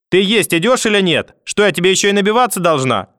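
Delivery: irritated, loud yelling; angry shout. Acted. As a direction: snap at him across the house. Grandmother yelling for dinner. (сердитый крик)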